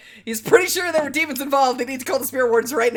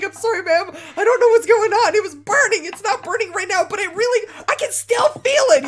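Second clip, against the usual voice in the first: high, loud, panicky apology; freaked out; 10 semitones higher, 5 dB louder than usual. Acted. Scared man apologizing to Maya about his burning hand. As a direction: babble it out, rattled and scared. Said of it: "he is completely freaked out of his mind".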